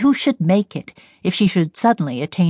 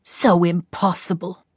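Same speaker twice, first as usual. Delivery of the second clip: disparaging; 3 dB quieter than usual. A narrator's reading. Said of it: "with a disparaging glance".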